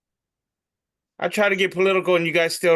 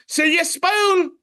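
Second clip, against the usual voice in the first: cartoon voice; 12 semitones higher, slower than usual.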